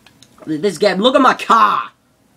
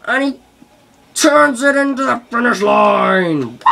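higher, louder mock race-caller; sports commentator; 4 semitones higher, 4 dB louder than usual. Gamer narrating his own win, mock commentator call.

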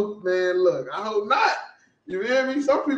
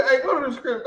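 normal talking voice

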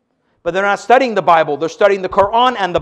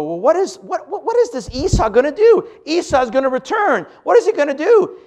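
much higher, half-laughing bewilderment; rising, amused what is he gonna do; mock puzzled question; 9 semitones higher than usual. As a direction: ask it as a baffled listener, chuckling. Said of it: "So you go".

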